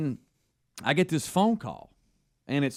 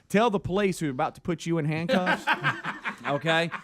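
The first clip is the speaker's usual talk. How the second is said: laughing; 4 semitones higher than usual. Real, not acted. Laughing mid-quote.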